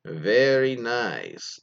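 put-on very slow, drawn-out Borat accent. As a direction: stretch it out in a mock Borat accent, rolling the R. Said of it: "in his best Borat impression".

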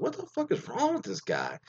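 high-pitched, softened mock outburst; exasperated disbelief. Driver reacting to guy pacing his car, exasperated squeak. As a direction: squeal it in baffled annoyance, without volume.

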